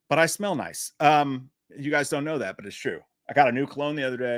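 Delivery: ordinary delivery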